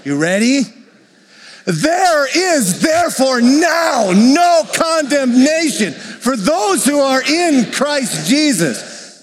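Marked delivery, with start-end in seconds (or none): 1.6-9.2 fervent full-voiced scripture reading; read like you mean it. Shown as a demonstration. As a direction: shout the verse out like it changes everything. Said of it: "I want you to read it like you mean it".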